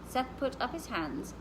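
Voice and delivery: usual speaking voice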